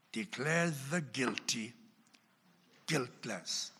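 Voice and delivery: ordinary talk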